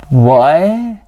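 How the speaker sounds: loud incredulous what; baffled what. Real, not acted. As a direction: react in disbelief. Baffled reaction to weird story.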